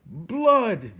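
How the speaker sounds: high, loud gleeful cry; chorus of rats. Acted. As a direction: cry it out as one hungry mob. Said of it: "they all agreed together".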